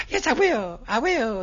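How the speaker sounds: high, loud, keen agreement; eager young man. Retold or imitated. Stranger keenly agreeing to go see Peter, high and eager. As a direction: chirp yes like an overeager scout.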